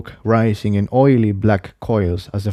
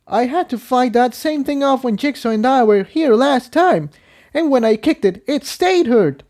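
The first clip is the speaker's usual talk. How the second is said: much higher voice, puzzled; young mare puzzled; 14 semitones higher than usual. Acted. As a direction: explain what happened last time. Character voice held.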